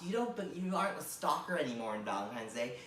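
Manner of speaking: ordinary delivery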